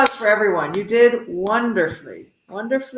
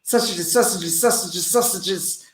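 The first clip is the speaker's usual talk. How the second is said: rapid-fire repetition; faster. Shown as a demonstration. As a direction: rattle the words off quickly. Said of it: "I'll try it even faster".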